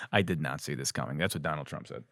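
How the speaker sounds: ordinary delivery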